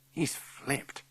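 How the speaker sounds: hushed whispered aside. A narrator's reading. Whispering to Strawberry about the teacher, hushed whisper. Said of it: "I whispered to Strawberry".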